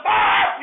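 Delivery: loud high yell of rage; high furious shriek; frustrated yell. Retold or imitated. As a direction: let out a raw scream of frustration. Scream after losing it.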